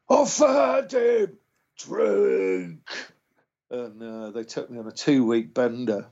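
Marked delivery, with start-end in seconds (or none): 0.1-2.8 high, slow, hammed-up boozy cheer; rowdy skinhead greeting. Skinheads in the doorway greeting him, high drawn-out boozy cheer. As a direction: stretch it out like a drunk geezer welcoming a mate.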